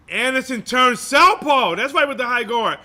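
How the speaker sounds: excited call. Real, not acted. Surge in live call.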